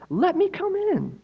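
high, put-on wolf voice; sly wolf. Wolf at the straw house door, high sly voice. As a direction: coax like a sneaky cartoon wolf.

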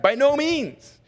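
"By no means" with NO lifted high, then falling; emphatic denial. Real, not acted. Pastor repeats Paul's denial to stress it, emphatic "By no means". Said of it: "It can't be more emphatic than that".

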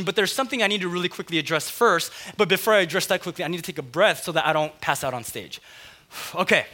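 usual speaking voice

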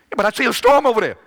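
high, loud, fast panicky cry; panicked sheep. Acted. Frightened sheep crying out to David, high and fast. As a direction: sheep sees the storm and panics.

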